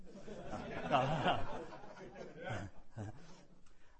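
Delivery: long laugh breaking out after a joke. Real, not acted. Preacher to congregation after joking he is done, laughing. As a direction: laugh along with the room.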